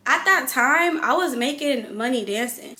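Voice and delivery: ordinary delivery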